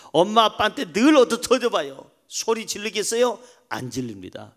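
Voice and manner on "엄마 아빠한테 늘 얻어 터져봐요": ordinary delivery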